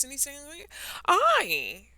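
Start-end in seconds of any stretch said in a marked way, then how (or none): 1.1-1.9 insulted. Acted. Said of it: "The caterpillar was insulted".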